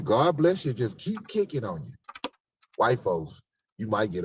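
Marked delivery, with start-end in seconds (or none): 0.0-0.7 higher, louder God bless you; a quick dismissive blessing; imitating churchy blessing. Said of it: "they'll hit you with a".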